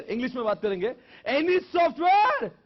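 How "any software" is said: very high, loud, drawn-out call; slow, ringing teacher's shout; emphatic dictation. Shown as a demonstration. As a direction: stretch the words out in a shout. Repeating definition loudly in English.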